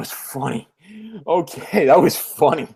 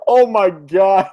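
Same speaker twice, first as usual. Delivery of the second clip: slow, spent from laughter; laughing hard; 3 dB louder, slower than usual. Real, not acted. Co-host after the Goblin impression, worn out laughing. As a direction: draw it out through real laughter.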